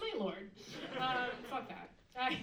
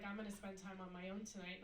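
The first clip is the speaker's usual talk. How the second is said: put-on calm boyfriend; 3 semitones lower, 8 dB quieter than usual. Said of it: "And he's like".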